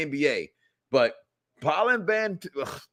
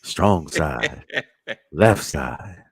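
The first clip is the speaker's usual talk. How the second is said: very deep, hammed-up seducer voice; Chris Pratt; 12 semitones lower, slower than usual. Host imitating a man wooing with movie lines, deep seduction. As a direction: drag it out low like a smooth pickup line. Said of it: "let me try to sound like Chris Pratt".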